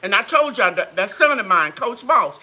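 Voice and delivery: normal talking voice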